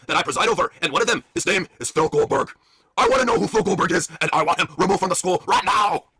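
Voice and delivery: Bret Hart. Acted. Principal Bret addressing teachers, stern put-on voice. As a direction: make a grave announcement to the staff. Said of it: "I'll be Brett".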